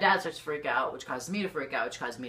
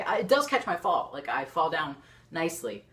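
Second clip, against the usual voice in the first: usual speaking voice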